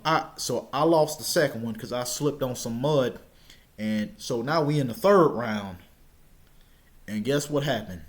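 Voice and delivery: ordinary talk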